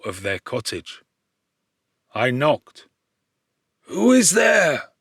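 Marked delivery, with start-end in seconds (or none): 3.8-4.9 put-on high, slow old man's call; high slow call of an old blind man. A narrator's reading. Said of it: "said the old man".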